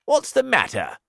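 high, loud concerned grasshopper. Acted. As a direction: ask it high and worried. Grasshopper asks James.

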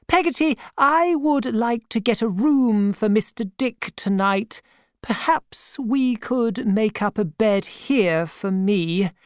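put-on a kindly, no-nonsense old aunt greeting an old acquaintance; elderly aunt. A narrator's reading. Aunt's voice, held.

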